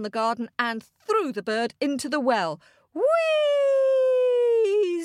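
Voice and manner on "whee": very high, drawn-out whee; long high-pitched falling cry. A narrator's reading. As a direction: squeal like something sailing down a well. Bird falls into the well.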